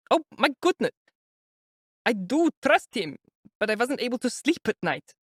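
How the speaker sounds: high agitated mother protesting; anxious exclamation. A narrator's reading. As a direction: cry it out like a worried old mother. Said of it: "the poor woman exclaimed".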